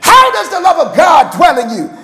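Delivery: normal talking voice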